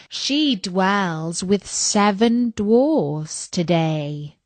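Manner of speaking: slow, solemn oracle chant; mirror's otherworldly slow recitation; magic mirror. Acted. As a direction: intone the verse slowly, like an oracle. Magic mirror answers in verse.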